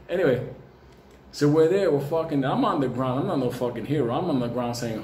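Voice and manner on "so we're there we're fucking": ordinary delivery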